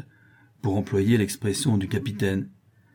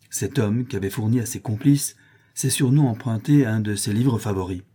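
ordinary delivery, nothing marked

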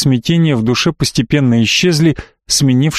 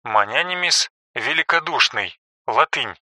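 soft footnote reading; quiet aside; 8 dB quieter than usual. A narrator's reading. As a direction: drop the voice for a footnote.

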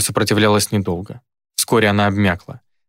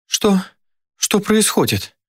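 high, slow trembling question; a girl waking in terror; terrified girl; 11 semitones higher, slower than usual. A narrator's reading. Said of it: "её трясёт от ужаса".

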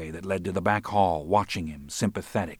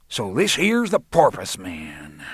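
slow taunting tough-guy drawl; mocking thug; 4 dB louder than usual. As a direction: sneer it out slowly like a bully. Roland taunting McGuire.

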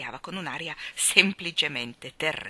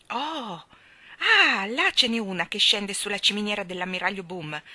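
louder, eager cry; excited exclamation; 4 semitones higher, 7 dB louder than usual. A narrator's reading. Michael spotting a shooting star for Jane, loud and excited. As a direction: point it out, thrilled. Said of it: "esclamò Michael".

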